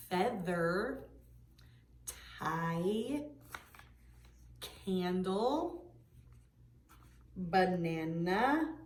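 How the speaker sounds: each word stretched out, carefully separated; name each picture slowly, drawing out the vowels; slow word list. Shown as a demonstration. Teacher naming each picture for the class, slow and stretched. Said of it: "Say the name of each picture".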